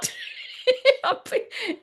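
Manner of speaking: a high squealing laugh; sudden squeaky laugh; high squeal of laughter. Real, not acted. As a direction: burst into a shrill giggle. Laughing at the drama.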